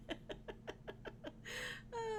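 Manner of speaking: soft, high run of giggles; helpless quiet giggling; laugh. Real, not acted. Breaking up while recapping the songs, laughing.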